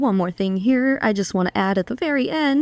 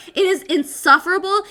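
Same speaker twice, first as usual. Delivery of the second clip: ordinary delivery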